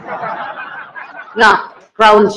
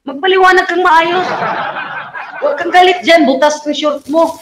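higher, teasing put-on voice scolding the basher; mock scolding; 6 semitones higher than usual. Host teasing an angry basher in mock scolding. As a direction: tease him like a sulky child.

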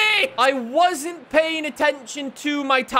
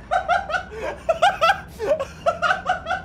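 helpless high laugh. Real, not acted. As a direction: burst out laughing, high and breathless. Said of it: "I'm crying".

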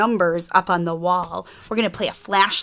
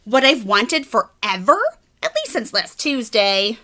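chatty pigeon; 3 semitones higher than usual. Acted. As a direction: chirp it like a cheeky pigeon. Reader voices the pigeon.

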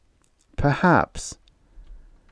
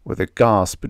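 ordinary delivery

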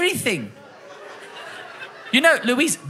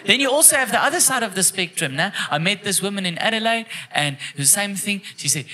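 usual speaking voice

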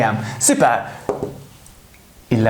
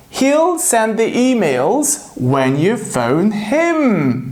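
very slow, every word spelled out; slow model sentence; 3 semitones higher, much slower than usual. Shown as a demonstration. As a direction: read it out slowly for learners. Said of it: "Last one".

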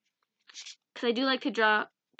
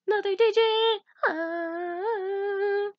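high excited squeal; 8 semitones higher, much slower than usual. Real, not acted. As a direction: squeal with delight. Excited card reveal.

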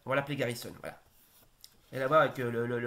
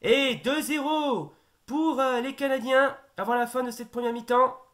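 high, loud goal call building to a shout; excited sports commentary; 9 semitones higher, 7 dB louder than usual. Acted.

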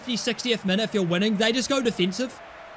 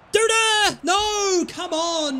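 frustrated outcry; 9 semitones higher, much slower than usual. Real, not acted. Another miss, frustrated outcry.

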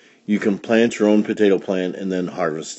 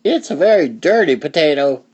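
higher voice for the wife; a hint of the farmer's wife, pitched up; lightly voiced wife; 5 semitones higher than usual. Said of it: "said his wife".

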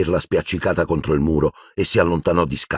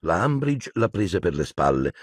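ordinary delivery, nothing marked

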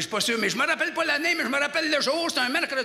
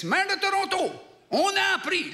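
high, emphatic envoy's announcement; hailing the mayor; 8 semitones higher than usual. Acted. Envoy brings Toronto's mayor the news, high and proclaiming. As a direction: address him in a high, hammed-up official call.

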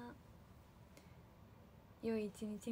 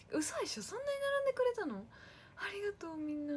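much higher, louder, amazed and touched; surprised; 11 semitones higher, 3 dB louder than usual. Real, not acted. Idol thanking fans who queued for merch, high amazed disbelief. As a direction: gasp it out in real disbelief.

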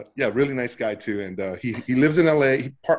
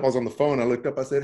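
usual speaking voice

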